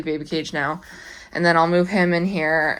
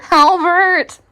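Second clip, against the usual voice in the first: high, loud call of a pet's name; sharp call; 10 semitones higher, 8 dB louder than usual. Real, not acted. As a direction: call him off, firm. Calling again louder.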